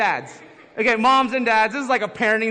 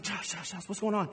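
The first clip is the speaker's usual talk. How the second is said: much softer, urgent night call; urgent wife; 8 dB quieter than usual. Retold or imitated. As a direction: wake him up quietly, worried. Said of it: "she's like".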